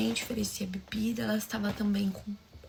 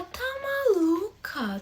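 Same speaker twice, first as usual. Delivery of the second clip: incredulous; 9 semitones higher, much slower than usual. Retold or imitated.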